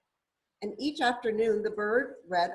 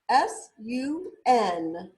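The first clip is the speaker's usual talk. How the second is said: letters spaced out slowly; S U N, one letter at a time; slow spelling; 6 dB louder, much slower than usual. Shown as a demonstration.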